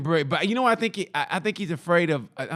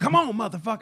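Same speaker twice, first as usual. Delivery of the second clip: imitated heckler challenge; 7 semitones higher than usual. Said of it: "going back and forth with the heckle".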